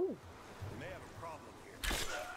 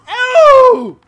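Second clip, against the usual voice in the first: laughing shout; 13 semitones higher, 17 dB louder than usual. Real, not acted. Laughing shout after a kill.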